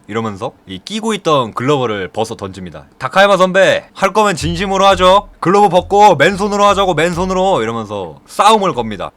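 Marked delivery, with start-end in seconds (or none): 3.0-7.6 loud, high furious challenge; angry challenge. Retold or imitated. Gentle Bakwi challenging Takayama to a bare-fist fight, angry. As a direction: throw down the gauntlet, raised voice. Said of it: "온화한 바퀴도 화가 났어요".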